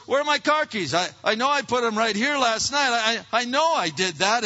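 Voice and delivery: high, loud, worked-up parent; frantic searching. Acted. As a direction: rant while hunting for something lost. Acted parent frantically hunting car keys.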